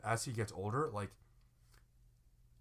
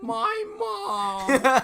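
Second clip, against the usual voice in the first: very high, loud falsetto wail; piercing mock tantrum, drawn out; childish falsetto; 11 dB louder than usual. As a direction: bawl it like a giant toddler. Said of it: "like, falsetto".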